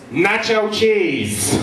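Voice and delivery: put-on high, slow little-kid punchline; child punchline. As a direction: deliver it like a proud toddler. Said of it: "I'm like".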